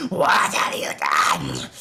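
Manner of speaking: loud, slow, low demonic rasp; undulating, turning voice. Acted. Monstrous mother slashing at Thorn, voice turning deep. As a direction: let the voice drop and turn monstrous. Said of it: "undulating and just changing as the voice kind of, uh, turns".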